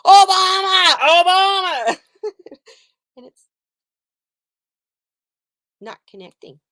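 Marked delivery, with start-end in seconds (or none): 0.0-2.0 loud, high mock shout of the name; frustrated inner yell, loud and high; mental shout. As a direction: yell the name inside your own head. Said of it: "in my head, I'm going".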